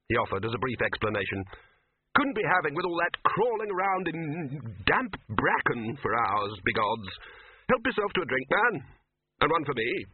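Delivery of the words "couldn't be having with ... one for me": higher, bluff hearty wizard boss; a hearty host waving off the hunt; Ridcully's voice. Acted.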